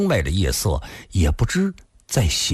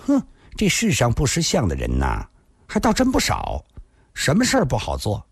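put-on little girl's high-pitched grumble; pouting grumble; 8 semitones higher than usual. A narrator's reading. Girl leaving in a huff, grumbling with a pout. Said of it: "嘴里还嘀嘀咕咕地说道".

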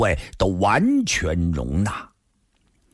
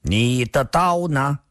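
slow, higher-pitched, measured question; word by word; 7 semitones higher, slower than usual. A narrator's reading. Shangguan Jinhong asking after the man's knife, word by word. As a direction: set each word down separately. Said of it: "上官金虹一字字说道".

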